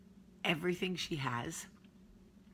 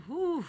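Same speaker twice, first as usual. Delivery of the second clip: whew; 13 semitones higher, 5 dB louder than usual. Real, not acted. Hair knots.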